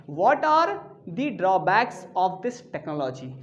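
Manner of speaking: "what are the drawbacks of this technology" said slowly, word by word; slow example sentence. Shown as a demonstration. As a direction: spell the example out slowly for students. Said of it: "तो बोल सकते हैं". (उदाहरण वाक्य धीरे-धीरे)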